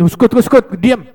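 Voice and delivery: loud, high command to shut up; acted shout for silence; urgent silencing. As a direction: cut the crier off with a shouted order. Acted hushing of the screaming man.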